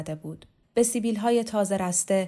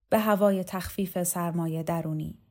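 plain reading voice

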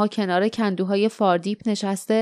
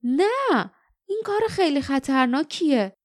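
high, sharp objection; a girl refusing the plan in alarm; alarmed protest; 5 semitones higher than usual. Acted. Jasmine objects to the decoy plan: high alarmed no. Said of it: "جاسمین گفت".

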